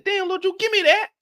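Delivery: high, surprised yelp; shocked. Retold or imitated. Host catches little Drew with a baggie, high shocked voice. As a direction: squeak it out in disbelief.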